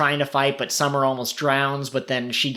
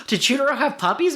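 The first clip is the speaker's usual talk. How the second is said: much higher, gasping astonished question; shocked gasp; 12 semitones higher, faster than usual. Retold or imitated. Host imitating Nagisa shocked about the puppies, high gasping voice. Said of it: "Nagisa even's like".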